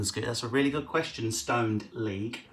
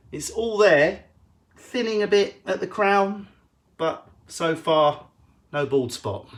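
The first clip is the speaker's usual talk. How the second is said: mock proud; 7 semitones higher, 6 dB louder, slower than usual.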